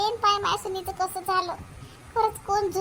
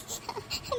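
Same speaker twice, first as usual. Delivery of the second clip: stifled sob; 12 dB quieter than usual. Acted. Girl crying again.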